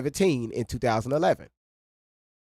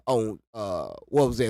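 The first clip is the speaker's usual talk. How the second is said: ordinary delivery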